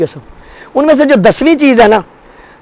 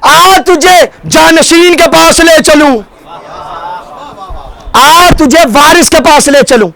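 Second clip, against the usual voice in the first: tender invitation; 4 semitones higher than usual. Retold or imitated. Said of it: "سینے سے لگا کے کہتے ہیں".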